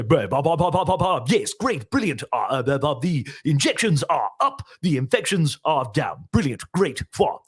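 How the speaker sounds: blustering caricature, full of babble; Boris Johnson. Shown as a demonstration. Impressionist shows the funnier stage version, blustering caricature. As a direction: overdo it, spluttering and bouncy. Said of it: "that's more accurate than what you go".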